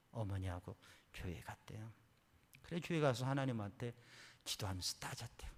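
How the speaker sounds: ordinary talk, nothing marked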